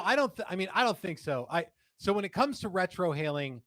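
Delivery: ordinary talk, nothing marked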